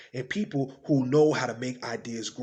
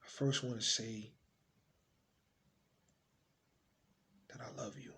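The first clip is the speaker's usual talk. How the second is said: tender; 5 semitones lower, 18 dB quieter than usual. Acted. Monologue to an unborn child, tenderly. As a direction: speak almost in a whisper, leaving space between phrases.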